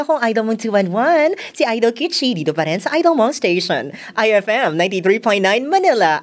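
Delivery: put-on feminine on-air greeting, starting very high; female radio DJ voice. Shown as a demonstration. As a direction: switch into a high-pitched woman's radio persona, opening at full volume. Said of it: "So, pwede ba ako mag-intro?".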